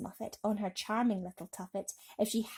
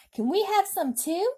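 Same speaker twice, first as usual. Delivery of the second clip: put-on much higher, loud children's voices; children crying out; 10 semitones higher, 8 dB louder than usual. A narrator's reading. Fifty children begging James for peach, high and loud. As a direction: plead eagerly as a crowd of kids. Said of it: "they cried".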